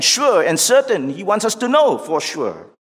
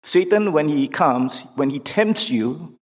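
ordinary delivery

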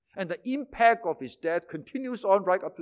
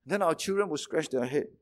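ordinary talk

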